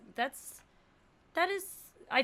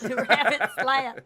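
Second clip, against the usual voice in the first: laughing through a slow call; laughing dinner call; 8 dB louder than usual. Retold or imitated. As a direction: giggle while calling out slowly.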